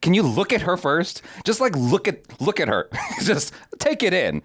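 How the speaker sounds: exasperated plea. Retold or imitated. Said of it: "And I'm like".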